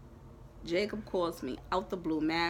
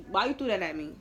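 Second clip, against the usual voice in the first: ordinary delivery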